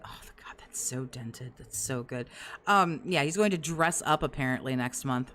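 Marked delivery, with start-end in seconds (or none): 0.0-2.3 hushed, unvoiced aside, much softer; breathless hush. Real, not acted. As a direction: lose your voice to near-whispered disbelief. Wheezing in amusement at the streamer.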